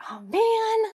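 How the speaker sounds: high, drawn-out lament; mock dismay. Audience member losing sight of a favorite number, dismayed. Retold disappointment at blocked view.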